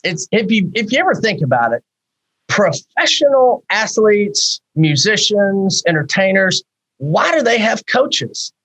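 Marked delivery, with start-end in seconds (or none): none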